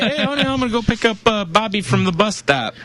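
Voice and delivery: high, fast sneaky husband; high mock husband voice. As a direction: chirp it like a sly dad. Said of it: "Yeah, like".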